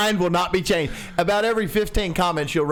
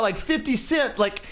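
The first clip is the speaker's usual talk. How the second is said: normal talking voice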